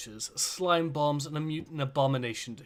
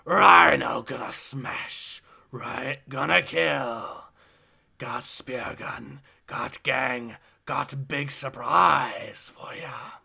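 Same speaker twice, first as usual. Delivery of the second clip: Rhino voice; 4 dB louder, much slower than usual. Acted. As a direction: talk like a dumb hulking brute. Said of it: "you wanna do the voice of the Rhino".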